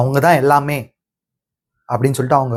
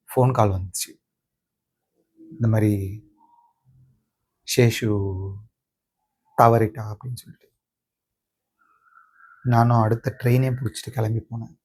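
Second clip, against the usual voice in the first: grieving; 2 semitones lower, 5 dB quieter than usual. Acted. Grandson recalls the call that grandmother died, grieving. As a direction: let the sentences sink softly into grief.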